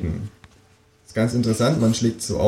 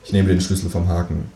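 plain reading voice

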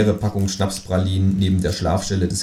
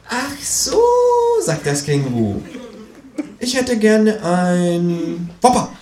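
higher, slow and deadpan kangaroo; 13 semitones higher, slower than usual. Acted. Kangaroo ordering at the counter, slow and higher. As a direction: order it drawn out like a stubborn kangaroo.

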